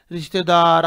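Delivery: usual speaking voice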